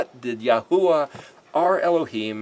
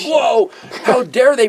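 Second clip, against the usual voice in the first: mock outrage; 8 semitones higher, 5 dB louder than usual. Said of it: "it's like".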